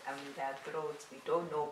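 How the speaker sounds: ordinary talk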